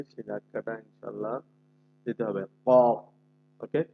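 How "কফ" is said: কফ with a sharp throat push; qaf with a push. Shown as a demonstration. Teacher shows qaf push again. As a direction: hit কফ hard from the throat. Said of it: "কফের নিজস্ব যে ধাক্কা আছে".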